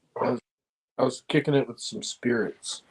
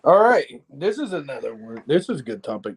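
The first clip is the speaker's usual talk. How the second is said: usual speaking voice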